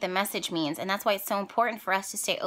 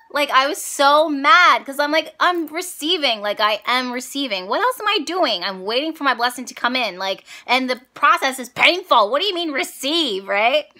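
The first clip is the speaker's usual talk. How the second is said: higher, louder indignant rant; annoyed rant; 5 semitones higher, 6 dB louder than usual. Retold or imitated. Vlogger ranting back at her ancestors, loud and annoyed. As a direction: vent at the ancestors like they're being ridiculous.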